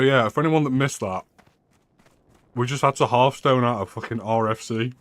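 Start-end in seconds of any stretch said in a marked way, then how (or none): none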